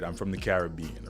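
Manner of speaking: ordinary talk, nothing marked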